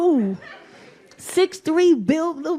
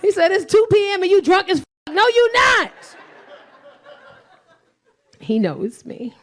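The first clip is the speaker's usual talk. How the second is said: ordinary talk, nothing marked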